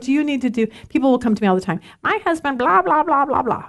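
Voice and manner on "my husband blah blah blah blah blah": imitated nagging complaint, higher pitched; mock complaining. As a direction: run the blahs together as a mock gripe. Acting out people's complaints about spouses.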